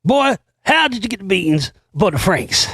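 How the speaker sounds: comic movie-quote voice; movie character impression.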